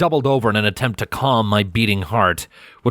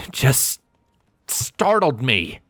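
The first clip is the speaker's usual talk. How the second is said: insisting; 4 semitones higher, much slower than usual. A narrator's reading. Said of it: "I insisted".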